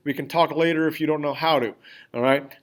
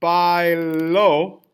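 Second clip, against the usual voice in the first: Bailo as bai-LÓ, drawn out, the last syllable rising; stress on final syllable; 4 dB louder than usual. Shown as a demonstration. Teacher contrasting two sentences for class, Bailo stressed on the O. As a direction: hit the final O of Bailo, held long. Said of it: "when I add the accent".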